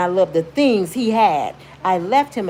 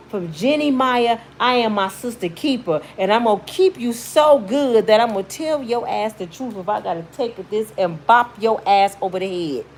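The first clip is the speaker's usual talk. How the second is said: usual speaking voice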